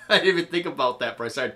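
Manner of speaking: fast, laughing through the words. Real, not acted. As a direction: rush it out while laughing. Embarrassed laughing after oversharing.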